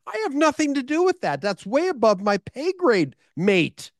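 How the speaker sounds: high, fast disbelieving denial; squeaky mock-outraged denial; exasperated protest. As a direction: brush it off as absurd. Retold protest to host.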